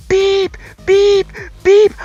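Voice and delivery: very high, loud beeping like a car alarm; imitated car alarm. Car alarm going off next to him, loud high beeps. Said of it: "it's like".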